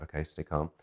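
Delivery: very soft aside to himself; steady yourself under your breath; hushed self-talk. Retold or imitated. Nervous man telling himself to stay cool, hushed.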